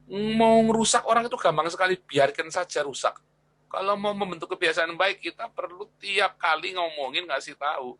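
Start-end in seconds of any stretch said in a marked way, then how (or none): 0.1-3.2 emphatic exclaim. Real, not acted. Emphatic teaching point.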